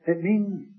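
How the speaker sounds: ordinary talk, nothing marked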